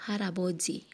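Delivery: ordinary talk, nothing marked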